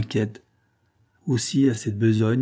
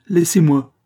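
higher, louder curt order; fierce shout; 6 semitones higher, 7 dB louder than usual. A narrator's reading. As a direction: push them back. Armed father shouting.

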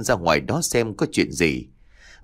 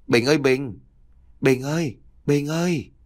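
higher-pitched, lightly eerie name-calling; ghostly calling; 5 semitones higher than usual. A narrator's reading. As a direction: call his name like an unseen presence. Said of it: "lại có tiếng ai đó gọi vào".

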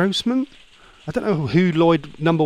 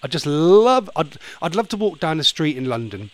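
normal talking voice

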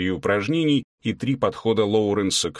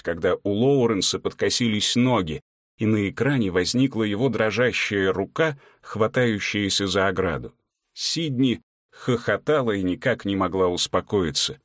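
usual speaking voice

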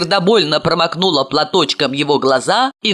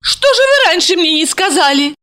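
high excited woman's exclamation; exclaiming; 11 semitones higher than usual. A narrator's reading. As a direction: cry it out eagerly. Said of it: "воскликнула Ася".

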